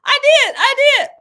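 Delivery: high, loud, excited cry of I did; proudly exclaiming that she really made it. Real, not acted. Excited about making steak.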